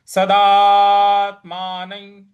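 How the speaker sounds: सदात्मानं with the दा drawn out long; slow, loud सदात्मानं with long दा; long daa. Shown as a demonstration. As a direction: stretch the दा in सदात्मानं. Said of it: "दा है उसको दीर्घ खींचना है।".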